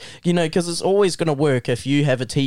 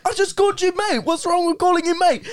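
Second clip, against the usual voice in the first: high-pitched, baffled kid imitation; innocent protest. New kid defending himself to the teacher, protesting.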